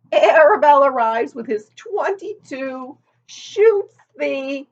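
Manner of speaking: put-on a gossipy old sheep leaning in. A narrator's reading.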